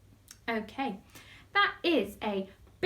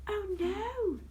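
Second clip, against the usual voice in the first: high gasp then oh no; 9 semitones higher, 4 dB quieter than usual. Pretend. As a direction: act shocked for the kids. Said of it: "Can we go".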